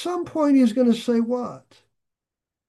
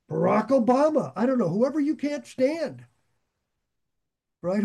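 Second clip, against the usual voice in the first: ordinary talk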